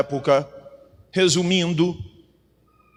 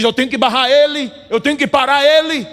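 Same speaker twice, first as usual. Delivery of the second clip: lightly voiced enemy, pitched higher; determined adversary; 7 semitones higher, 4 dB louder than usual. Enemy vowing to stop the preacher, mock determination. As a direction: voice an opponent's insistent resolve, raised pitch.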